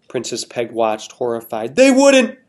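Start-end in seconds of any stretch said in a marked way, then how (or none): none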